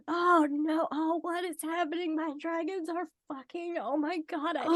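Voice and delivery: high-pitched, hammed-up distress; mock panic. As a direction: play a flustered character in a panic. Acting out character's panic over dragons.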